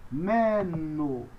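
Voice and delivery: "meno" in two long syllables; pronunciation demo. Shown as a demonstration. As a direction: draw out "meno" for learners. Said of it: "A próxima".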